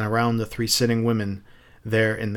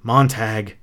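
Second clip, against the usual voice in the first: ordinary delivery, nothing marked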